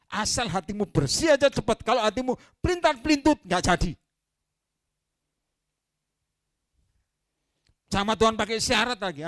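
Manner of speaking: high, loud preacher's cry; rising pulpit passion; fiery preaching. Acted. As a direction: drive the point home to the church. Preaching at full force.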